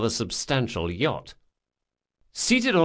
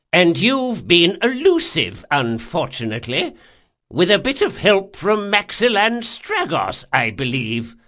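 put-on high, loud old man's voice; haughty old man; 7 semitones higher, 6 dB louder than usual. Old man owns up to sending assassins, unrepentant. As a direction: speak as an unrepentant old schemer.

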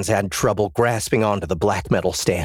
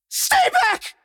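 panicked yell with a cracking voice; high, cracking, terrified shout. Acted. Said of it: "I yelled. My voice cracked".

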